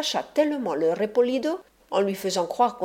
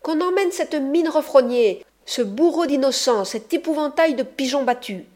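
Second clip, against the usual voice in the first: high, loud angry cry through a door; a woman shouting him away behind a closed door; 6 semitones higher, 5 dB louder than usual. A narrator's reading. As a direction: yell at him from inside the room. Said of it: "à grands cris".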